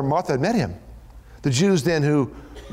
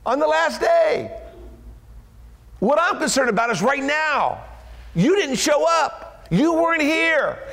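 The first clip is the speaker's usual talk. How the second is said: Martha pleading; 8 semitones higher, slower than usual. Acted. Martha voiced protesting to Jesus.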